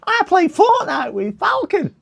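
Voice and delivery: very high, slow silly voice; imitating Worms. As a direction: squeak it out slowly like a cartoon kid. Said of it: "I think Worms talks like this".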